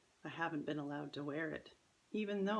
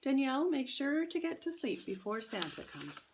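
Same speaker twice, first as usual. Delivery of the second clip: higher, louder call over the shoulder; mother calling to her daughter while leaving, higher and louder; calling behind her; 9 semitones higher, 5 dB louder than usual. Acted. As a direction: call back into the house.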